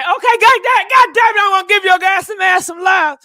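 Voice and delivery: high, loud, fast exasperated yell; mock angry shout. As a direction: bark it out fed up. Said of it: "But he said".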